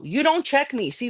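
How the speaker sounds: usual speaking voice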